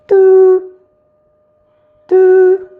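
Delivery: high, loud imitated dial tone; phone ring. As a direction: mimic a ringing phone line. Phone ringing while calling dad.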